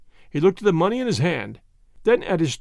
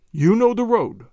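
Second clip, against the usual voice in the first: mildly rough demand, pitched higher; 4 semitones higher, 4 dB louder than usual. A narrator's reading. Agent questioning the cart owner about the road, somewhat rough. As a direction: press the point with some roughness. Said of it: "repeated Chauvelin roughly".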